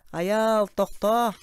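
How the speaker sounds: high, loud drawn-out call; acted urgent holler; shouted stop call. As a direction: shout to halt someone. Calling someone to stop.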